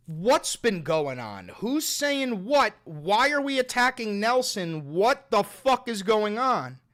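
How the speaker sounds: loud, drawn-out incredulous questions; fed-up, emphatic questioning; exasperated demand. Real, not acted. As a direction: demand an explanation, stretching the words in exasperation. Demanding answers about chat feud.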